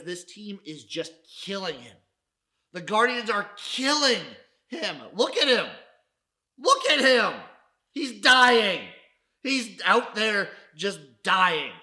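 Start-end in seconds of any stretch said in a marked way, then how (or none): 2.7-11.7 louder and higher, mock distress; hammed-up anguish. Host hamming up grief for the struggling coach, loud. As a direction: cry out as if the coach is truly dying.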